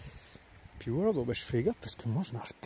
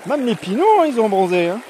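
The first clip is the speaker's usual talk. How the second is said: excited; 9 semitones higher, 13 dB louder than usual. Real, not acted. Joking loudly in the water.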